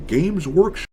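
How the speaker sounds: cute anime streamer. Acted. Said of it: "What the heck was that voice?".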